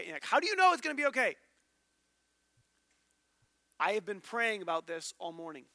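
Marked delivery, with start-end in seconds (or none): 0.2-1.4 fast, higher, louder disbelief; a rushed, disbelieving challenge; incredulous question. Retold or imitated. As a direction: demand to know how he knows. Incredulous listener questions brother.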